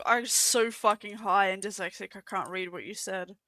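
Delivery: ordinary delivery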